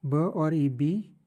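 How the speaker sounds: ब and ई slowly blended into बी; syllable building. Shown as a demonstration. Teacher to class, building a syllable slowly. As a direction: slowly join ब and ई to say बी. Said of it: "इसको ब pronounce करते हैं".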